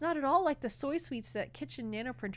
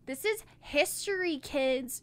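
excited; 6 semitones higher, 6 dB louder than usual. Acted. Mars proud announcement.

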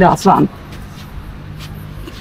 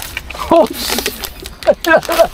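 breathy laugh; 7 semitones higher, 5 dB quieter than usual. Real, not acted. Laughing hard.

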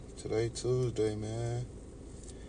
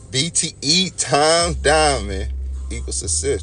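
slogan; 5 semitones higher, 5 dB louder, slower than usual. Acted. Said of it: "Don't forget that shit".

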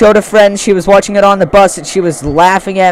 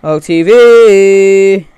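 ordinary talk

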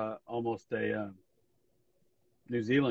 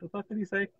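higher, put-on confused question; imitating yourself puzzling over a voice message; half-laughing retold confusion; mock bafflement; 8 semitones higher than usual. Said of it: "and I'll be like".

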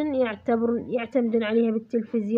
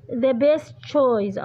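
very slow, word by word, for writing down; slow dictation; much slower than usual. Shown as a demonstration. Teacher dictating the answer to her students, slow and deliberate. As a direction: spell it out slowly so students can copy.

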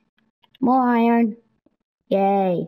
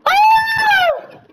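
very high loud cry; high startled shout; 1 shocked shout; 5 dB louder than usual. Real, not acted. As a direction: yelp in shock. Sudden surprise.

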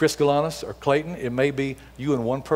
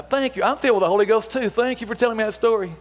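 excited thanks; 8 semitones higher than usual. Acted. Answering her testimony with joy.

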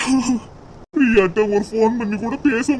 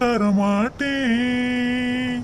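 slow, aching vow through tears; tearful vow; 3 semitones higher, much slower than usual. Acted. Son vowing to absent Priya, slow and tearful. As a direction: draw out each word like a broken promise.

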